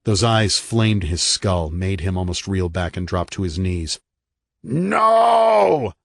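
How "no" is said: high, loud cracking scream of no; ragged scream. Acted. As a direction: tear the word out in a ragged scream. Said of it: "the voice within him screamed raggedly".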